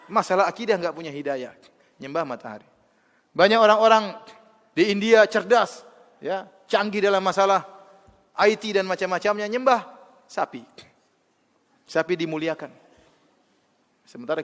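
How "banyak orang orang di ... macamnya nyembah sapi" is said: louder and higher, hammering each phrase; forceful preaching. Acted. Preacher scorning clever idol worshippers, loud and rising. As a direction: build up the outrage before the punchline.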